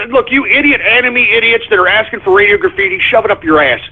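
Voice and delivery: ordinary talk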